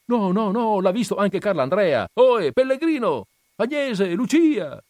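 high, loud calls; insistent calling. Acted. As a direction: call the names again into the house. Villager insists and calls again.

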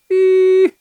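high, loud squeal; playful high yelp; mocking exclamation. A narrator's reading. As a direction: tease him with a sharp cry. Teasing exclamation at him.

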